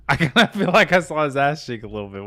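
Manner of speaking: can't believe what he just saw, laugh through it; amused, loud, high disbelief; giggly disbelief. Real, not acted. Laughing at a naked friend on camera.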